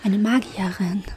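hushed mysterious whisper. Pretend. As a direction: whisper it like a secret spell. Mysterious magician claim, whispered.

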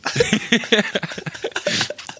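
high breathless giggle; can't stop laughing; helpless laughter. Real, not acted. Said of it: "Ich kann nicht mehr aufhören lachen".

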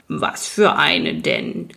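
low, slow creaking growl of a stone giant; creaky rock-biter voice. Acted. Rock biter greets the newcomer by the fire, slow creaky rumble. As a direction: grind the words out slowly like rock. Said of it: "knarzte der Felsenbeißer".

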